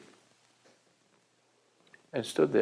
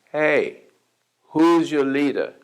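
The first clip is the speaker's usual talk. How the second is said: raised challenge, slowed down; calling out; 4 semitones higher, 7 dB louder, slower than usual. Retold or imitated. Confronting the gang in the street, a loud challenge. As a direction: call out to the crowd, demanding.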